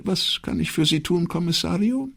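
high-pitched, dutiful secretary; businesslike. Acted. Secretary turns official to the commissario, businesslike and high. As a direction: offer help in an official secretary's tone. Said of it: "Dann wurde sie dienstlich".

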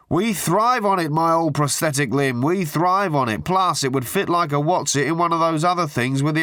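butter him up with absurd pet names; gushing hack journalist. A narrator's reading. Tabloid journalist gushing down the phone at Arthur. Murray's voice again.

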